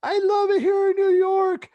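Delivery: very high, loud, drawn-out mock joy; retold gushing cry. Girl declaring her love for New York, high imitated yell. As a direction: belt it out slowly in a high voice. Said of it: "this girl goes".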